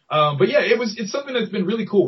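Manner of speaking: ordinary delivery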